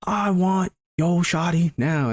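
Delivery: drawn-out put-on bravado; swaggering rival suitor. Intruder claiming the speaker's girlfriend, swaggering put-on voice. Imagined rival bursting through window.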